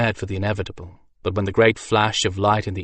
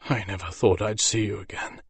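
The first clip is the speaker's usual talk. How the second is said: a touch of a hoarse, hushed voice; mild rasp, barely above a breath; hoarse whisper; 4 dB quieter than usual. Acted. Wounded mage greeting the griffin, hushed and hoarse. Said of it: "he whispered hoarsely".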